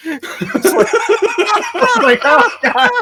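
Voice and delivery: laughing too hard to finish the sentence. Real, not acted. Laughing at the Swedish video.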